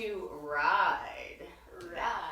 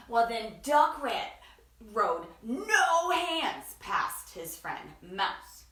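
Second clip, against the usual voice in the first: plain reading voice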